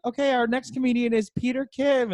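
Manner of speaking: high, announcing host voice; show host introduction. Retold or imitated. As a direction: introduce the next act like an emcee. Imitates host introducing him onstage.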